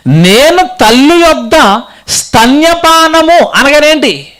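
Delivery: high, projected verse and questioning; declamatory preaching. Acted. Preacher explains mother's milk verse, declamatory preaching.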